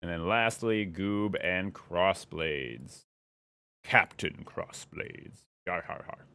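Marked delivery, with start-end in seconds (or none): 3.8-6.2 pirate voice. Pretend. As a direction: play a gruff pirate. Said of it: "Talk Like a Pirate Day".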